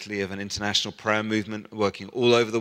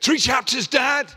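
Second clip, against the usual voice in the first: much higher, louder mock son; son's voice; 14 semitones higher, 5 dB louder than usual. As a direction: squeak it out like the expectant son. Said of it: "he's gonna say".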